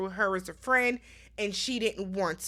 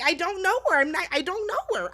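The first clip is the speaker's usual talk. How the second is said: higher-pitched imitation of someone disowning an acquaintance; mock denial; 7 semitones higher than usual. Wendy denying she knows the new castmate, mock insistent.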